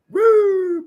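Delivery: very high, loud imitated whoop; cop whoop. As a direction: mimic the cop's sudden whoop. Cop's siren-like whoop.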